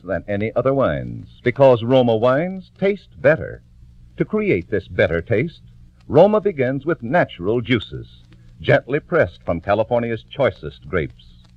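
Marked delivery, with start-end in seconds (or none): none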